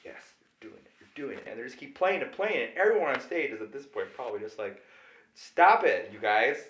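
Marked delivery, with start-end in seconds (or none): 0.0-1.5 hushed, half-whispered encouragement; imitated intense, soft approval; getting into it. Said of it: "kinda into it now". 5.3-6.7 mock fed-up protest; exasperated plea. Players pleading on stage, retold.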